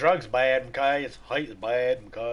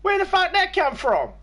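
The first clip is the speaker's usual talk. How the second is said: high, loud, laughing disbelief; stunned disbelief; 6 dB louder than usual. Retold or imitated. Answering the nine eleven viewer in high, loud laughing disbelief. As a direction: yelp it in shock, laughing. His own stunned reply retold.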